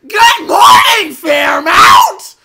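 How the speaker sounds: screamed, high and very loud; screaming wake-up call. Retold or imitated. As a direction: scream it to wake the whole cabin. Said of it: "it wasn't like that".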